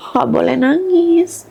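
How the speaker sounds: put-on high, slow child's voice; imitating young daughter. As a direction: mimic a child soothing a crying mother. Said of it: "dia bilang gitu".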